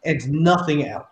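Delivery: loud, griping soldier voice; aggressive man complaining. Acted. GM voices a soldier complaining to his superior, loud and fed up. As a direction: grumble it out like a fed-up enforcer, slow and loud. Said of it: "the male voice, which sounds to be, I wouldn't say complaining".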